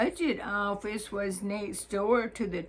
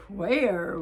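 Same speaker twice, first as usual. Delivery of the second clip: where pushed out louder and falling; clerk's astonished where, louder and falling; emphasized where; 5 dB louder than usual. Retold or imitated. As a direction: lean on the word where, louder with a falling tune. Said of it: "was emphasized".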